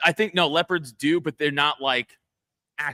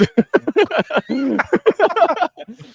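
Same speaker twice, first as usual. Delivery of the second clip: high, loud cackling laugh; loud burst of laughter; 13 semitones higher, 8 dB louder than usual. Real, not acted. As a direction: cracks up loudly. After Herzog quote.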